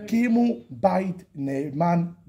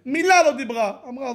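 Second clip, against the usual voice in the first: ordinary talk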